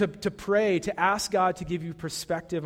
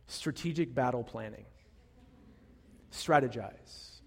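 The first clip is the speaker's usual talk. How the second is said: scheming dark side; 5 semitones lower, 6 dB quieter than usual. Pretend. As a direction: plot it out quietly like a villain. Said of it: "here's the dark side that's inside of me".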